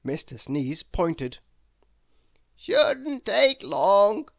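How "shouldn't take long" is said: high, loud, slow theatrical wizard. Acted. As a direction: savour every grand word like an eccentric wizard. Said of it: "said the wizard".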